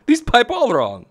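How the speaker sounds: put-on high, hammy Italian plumber accent; cartoon Italian accent, badly faked; terrible Mario accent. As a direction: grumble in a bad, high-pitched Mario accent. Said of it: "That's a terrible Mario accent".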